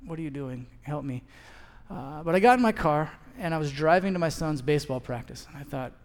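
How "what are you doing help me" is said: soft, exhausted plea, much quieter; tired whispered-like prayer; weary prayer. Retold or imitated. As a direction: breathe it out under your breath to God. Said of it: "you're just like".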